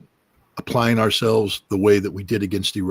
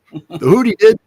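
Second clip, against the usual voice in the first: triumphant punchline; 11 semitones higher, 8 dB louder than usual. Acted.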